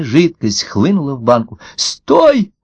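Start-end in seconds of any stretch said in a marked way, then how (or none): 1.8-2.5 high-pitched little fish crying out; sharp high cry of alarm; panicked cry. A narrator's reading. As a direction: yelp a frantic order to halt. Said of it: "крикнула рыбка".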